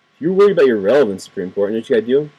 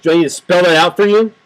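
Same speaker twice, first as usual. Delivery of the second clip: louder, higher sarcastic challenge; sarcastic jab; 6 semitones higher, 5 dB louder than usual. Real, not acted. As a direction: talk down to them. Mocking the justices.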